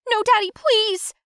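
high, pleading cry; scream. Acted. Girl waking from a nightmare, high pleading scream. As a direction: beg him in a high-pitched cry. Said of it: "I screamed as I opened my eyes".

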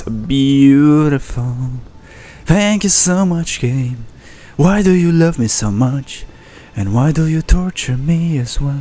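drawn-out, theatrical lament at the game; mock gratitude.

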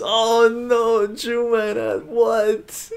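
high dismayed oh no; surprised. Real, not acted. Viewer missed the auction.

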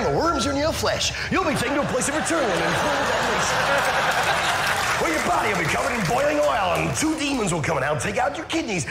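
put-on American accent; theme-park patter in an American accent. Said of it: "Hell in an American accent".

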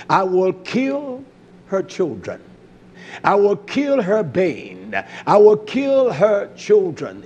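slow, weighty quote stretching KILL; drawn-out proclamation. Acted. Preacher quoting the threat against her children, slow and drawn out. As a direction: spell out the threat slowly, word by word. Emphatic repeated quotation of threat.

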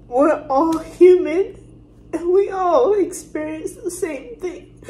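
emotional appeal that keeps dropping to a whisper; real crying. Real, not acted. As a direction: push the words out through tears, fading at pauses. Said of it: "I'm so sorry for crying".

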